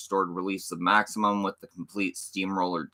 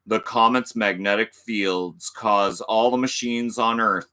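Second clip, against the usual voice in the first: dramatic trailer narration; movie trailer voice; 3 semitones higher, 4 dB louder than usual. Acted. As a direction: boom it out like a horror trailer.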